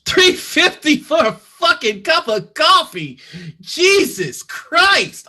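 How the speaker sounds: loud, high outrage at the price; outraged disbelief. Acted. Gruff customer shocked at coffee price, loud disbelief. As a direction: explode at the price, stretching Jesus Christ.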